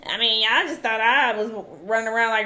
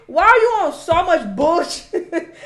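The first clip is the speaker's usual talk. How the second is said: higher and louder, slowed and laughing through it; laughing disbelief; 7 semitones higher, 5 dB louder, slower than usual. Real, not acted. Scolding [name], she breaks into laughing disbelief. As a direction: laugh at how ridiculous he is.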